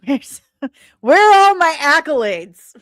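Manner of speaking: ordinary delivery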